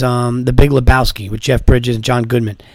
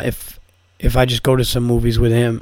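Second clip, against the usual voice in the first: usual speaking voice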